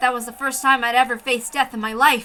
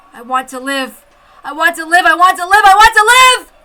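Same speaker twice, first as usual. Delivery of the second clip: each repeat higher and louder; escalating cry to live; rising desperate plea; 6 semitones higher, 11 dB louder than usual. Acted. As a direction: build from murmur to scream.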